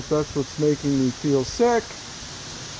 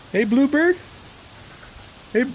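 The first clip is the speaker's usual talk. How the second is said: sing-song high call to a bird; high coaxing call; 7 semitones higher than usual. Real, not acted. Calling to a bird.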